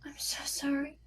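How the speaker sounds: breathless whisper of sorrow; whispered sympathy. Acted. Sue consoles the grieving father, whispering. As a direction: barely voice it, just air. Sue responds to his loss.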